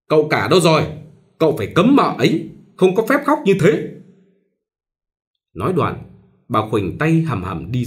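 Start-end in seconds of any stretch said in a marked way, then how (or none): 0.1-3.9 louder, higher angry call. A narrator's reading. Mother-in-law angrily calling her son, higher and louder. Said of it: "giận quá gọi".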